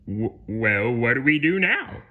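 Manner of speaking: stammer, then ask sweetly, rising; feigned innocence. Acted. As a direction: pitch climbing into an innocent question. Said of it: "Plankton asked innocently".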